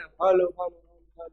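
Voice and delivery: hellos fading away; imitated multiple echo. Shown as a demonstration. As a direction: mimic an echo repeating and dying off. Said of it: "પછી વધારે વાર સંભળાય ને".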